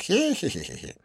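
high-pitched hee-hee; silly giggle. A narrator's reading. Dean tickled by his own fantasy, impish giggle.

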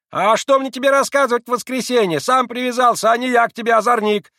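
high, loud angry market-woman scolding; scolding outcry. Acted. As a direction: tear into the boy at full voice. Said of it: "раскричалась Марья".

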